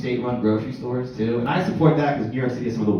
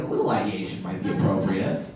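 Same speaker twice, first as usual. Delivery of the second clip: ordinary talk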